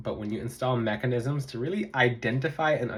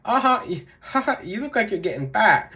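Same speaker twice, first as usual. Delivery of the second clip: higher-pitched jeering heckler; mock taunting; 8 semitones higher, 4 dB louder than usual. YouTuber retelling a live viewer's weight insult, mocking taunt. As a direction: imitate a bully's teasing jab.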